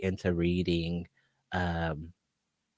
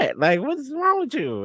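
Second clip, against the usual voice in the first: put-on much higher startled kid voice; confused child self. Little kid woken by brother, high and confused. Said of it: "I was like".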